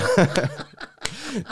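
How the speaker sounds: high-pitched burst of real laughter. Real, not acted. To his friend after the Shard fiasco, high laughter. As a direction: cracks up at his own story.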